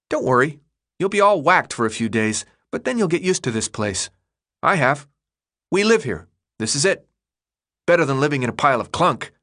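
put-on high boyish voice, eager and reassuring; chatty kid Chuck. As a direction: talk him down like an eager kid.

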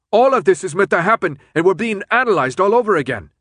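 loud, high, worked-up voice; yelled. A narrator's reading. Newt insisting they are being tested, yelled. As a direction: keep yelling over the noise. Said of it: "Newt yelled".

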